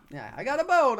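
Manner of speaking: high, loud hammy Italian boatman; Italian accent. Acted. Boatman answering the players, hammy Italian accent. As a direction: push the pitch up into a cartoon Italian.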